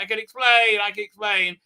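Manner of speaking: high, hurried-sounding excuse; mock pleading. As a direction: imitate someone caught out. Acted character pleading excuse.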